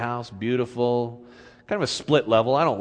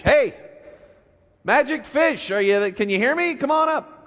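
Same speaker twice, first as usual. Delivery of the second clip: calling out; 12 semitones higher than usual. Acted. Husband calling to the sea.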